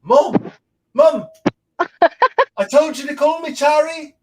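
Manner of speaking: put-on high, loud whiny man-child complaining to his mom; mock the basement dweller nagging his mom; whiny grown son. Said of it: "big fat dude talking about".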